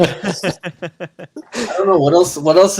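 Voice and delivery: laughing through the words. Real, not acted. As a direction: chuckle it out slowly. Group laughing at the joke.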